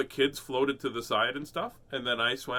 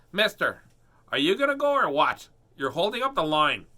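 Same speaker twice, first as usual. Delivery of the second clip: put-on higher, louder bossy little girl; imitating a pushy little girl; impatient girl; 4 semitones higher, 5 dB louder than usual. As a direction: nag like a kid in a queue. Impatient girl voiced in story.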